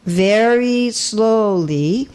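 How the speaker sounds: drawn-out, deliberate words; very slow. Shown as a demonstration. Speaker warns listeners about translation pace, very slow. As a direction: stretch each word right out. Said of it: "if I speak very slowly".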